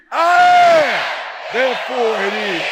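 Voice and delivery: high, loud declaration with attitude; shouted with attitude. Shown as a demonstration. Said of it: "I need a little attitude".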